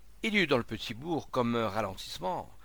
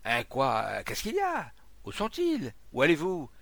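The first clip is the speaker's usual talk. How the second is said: alarmed questions, pitched up; startled questioning; 3 semitones higher, 5 dB louder than usual. Acted. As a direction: fire off bewildered questions like a crowd. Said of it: "Des interrogations se croisèrent".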